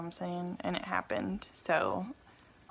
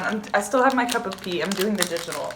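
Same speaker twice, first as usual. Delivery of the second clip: usual speaking voice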